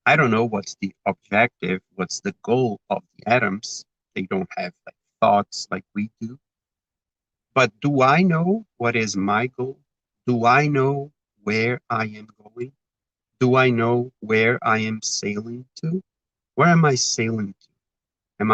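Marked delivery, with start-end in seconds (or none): none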